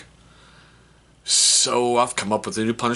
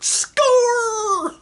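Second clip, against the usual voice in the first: high, long drawn-out victory cry; mock triumph; 4 dB louder than usual. Dad winning against his son, triumphant crowing. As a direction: crow it high like a winning point.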